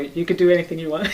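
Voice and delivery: quick, laughing brat tone; a light, half-laughing teen impression; mock bad teenager. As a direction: toss it off like a careless teenager. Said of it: "not like bad teenager".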